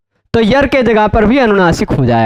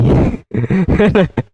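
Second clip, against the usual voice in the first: short laugh; 4 semitones lower than usual. Real, not acted. Laughs at his own joke.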